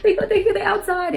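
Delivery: high-pitched, panicked plea to wake up; imitated crying child. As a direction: mimic a stepdaughter pleading through tears. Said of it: "She's crying".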